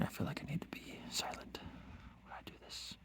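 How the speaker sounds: hushed, barely audible whisper. Real, not acted. Painter confiding to viewers while doing careful work, whispering. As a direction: whisper as if you need to stay silent. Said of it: "I don't know why I'm whispering".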